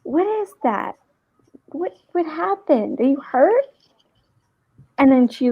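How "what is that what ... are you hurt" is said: put-on higher, curious child voice; the girl asking about her leg, higher and curious; little girl's voice. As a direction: ask it like a worried four-year-old. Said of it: "She goes".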